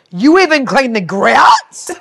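slow, loud, high-pitched amazement; hammed-up delighted disbelief; astonished. Retelling a customer amazed at the next day's job, astonished.

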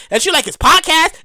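ordinary delivery

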